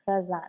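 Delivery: croissant, low, ending on a vowel, no T; silent T. Shown as a demonstration. Explaining French words in the book, croissant with silent T. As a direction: drop the T at the end of croissant. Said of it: "ta is not, uh, pronounced".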